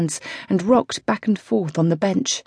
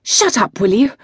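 loud, higher angry outburst; furious rider shouting at her coach; exploding at him; 4 semitones higher, 4 dB louder than usual. Acted. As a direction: snap at him to stop. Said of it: "Zoe exploded".

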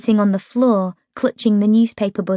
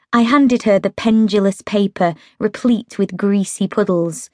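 ordinary delivery, nothing marked

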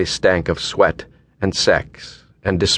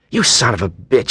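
raised-pitch angry cry, held back; mild acted scream; 4 semitones higher than usual. A narrator's reading. Woman shoving him as he dresses, mildly screamed. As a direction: scream it in narration, without full volume. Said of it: "she screamed".

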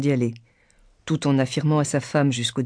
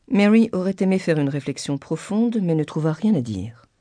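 usual speaking voice

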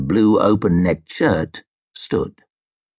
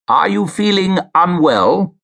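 concerned judge; 9 semitones higher than usual. A narrator's reading. Judge concerned for frail witness.

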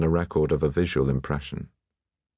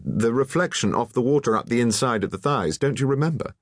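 higher-pitched, eager reminder; exclaiming; 6 semitones higher than usual. A narrator's reading. Alain reminding the painter of the reflection, exclaiming. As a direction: press the reminder, pitch climbing at the end. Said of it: "exclaimed Alain".

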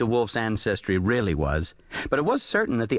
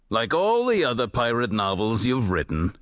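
sighed, weary and resigned; sighing; 5 semitones lower than usual. A narrator's reading. Jules sighs to the writer about all his pirate novels, weary. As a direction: sigh it out, tired of pirate novels. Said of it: "sighed Jules".

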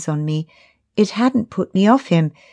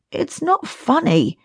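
annoyed; 7 semitones higher than usual. A narrator's reading. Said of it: "annoyed at me".

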